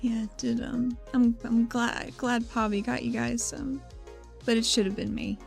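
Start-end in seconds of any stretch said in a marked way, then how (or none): none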